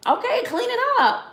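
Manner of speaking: high, breezy "clean it up"; easygoing mom. Retold or imitated. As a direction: tell the kids it's no big deal. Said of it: "I'm just like".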